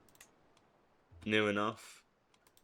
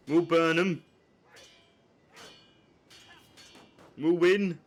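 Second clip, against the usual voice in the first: rallying shout; 5 semitones higher, 5 dB louder, slower than usual. Pretend. Rallying troops in battle.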